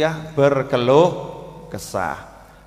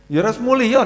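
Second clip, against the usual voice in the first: mock exclaim; 8 semitones higher than usual. Sick visitor mocked.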